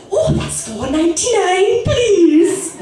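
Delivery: high-pitched Scottish shop assistant; Scottish accent, climbing high on please; put-on Scottish accent. Shown as a demonstration. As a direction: serve them in a high, silly Scottish accent. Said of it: "you have to serve him in that accent".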